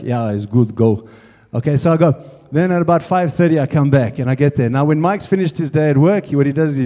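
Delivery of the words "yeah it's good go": deep mock Russian accent; low, terse Russian-accented dismissal; Russian-accented mechanic. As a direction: imitate a Russian mechanic waving someone off. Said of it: "He's got a big Russian voice".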